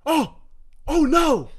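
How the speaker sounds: building from plain to high, loud wails; escalating cries of dismay. Retold or imitated. Retelling his friend finding a disaster, escalating dismay.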